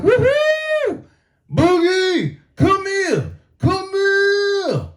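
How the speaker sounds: calling the dog in a high, excited voice; high-pitched happy shout to the pet. Retold or imitated.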